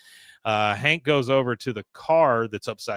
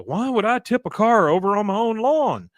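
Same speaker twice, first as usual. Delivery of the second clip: high-pitched, louder put-on indignation; acted protest; 9 semitones higher, 5 dB louder than usual. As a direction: plead innocence as if it were absurd. Willie protests innocence to cops.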